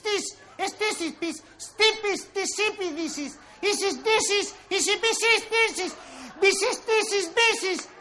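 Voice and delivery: nonsense babble. Acted. Tongue-tied gibberish spiral.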